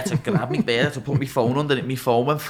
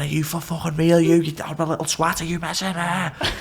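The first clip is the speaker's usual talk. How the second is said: retold rant; 6 semitones higher than usual. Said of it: "I started flipping".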